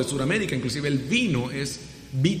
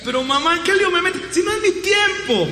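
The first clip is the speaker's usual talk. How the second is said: much higher, louder whiny protest; exasperated son; 5 dB louder than usual. Retold or imitated. Jesus protesting to his mother, exasperated. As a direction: whine like a cornered son.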